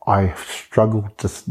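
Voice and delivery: usual speaking voice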